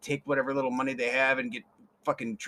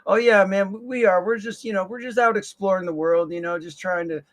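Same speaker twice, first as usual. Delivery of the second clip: put-on laid-back street kid; 4 semitones higher, 6 dB louder than usual. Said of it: "kids reply".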